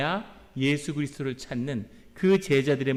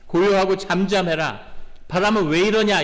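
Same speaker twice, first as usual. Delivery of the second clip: a single firm, loud command to the wind; Jesus rebuking the wind, loud and firm; firm rebuke; 4 semitones higher, 6 dB louder than usual. Retold or imitated. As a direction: rebuke the storm once, with authority. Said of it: "이렇게 딱 한마디 하시니까".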